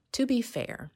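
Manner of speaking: high, loud lift on "to be fair"; playful interjection. Real, not acted. Interrupts herself, then laughs and restarts.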